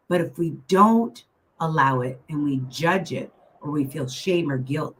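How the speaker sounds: ordinary delivery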